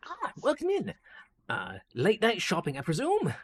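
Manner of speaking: high, lilting little shopkeeper voice; high-pitched gnome. Acted. Gnome shopkeeper welcoming late-night customers, high-pitched. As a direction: greet them in a squeaky gnome voice. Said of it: "this kind of high-pitch-ish voice".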